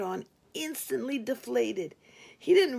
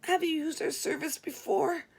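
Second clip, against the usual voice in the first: higher, a broken stammer; 6 semitones higher than usual. Retold or imitated. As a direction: force out the question while shaken. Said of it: "I stammered in a broken voice".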